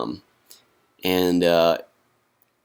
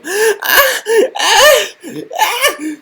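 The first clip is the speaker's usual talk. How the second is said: very high, loud startled shrieks dissolving into laughs; shrill mock scream; 8 dB louder, much slower than usual. Vlogger imitating kids screaming at his scary mask. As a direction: shriek like a scared kid then laugh. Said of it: "they go".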